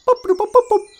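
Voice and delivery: high staccato mouth-made boops; computer beeps. Pretend. Holodeck computer answering a command, imitated beeps. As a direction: imitate a machine responding.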